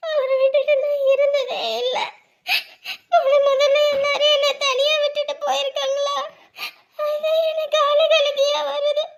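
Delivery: high, drawn-out sobbing; crying. Acted. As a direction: wail the words slowly through tears. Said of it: "ஏய், அழாத".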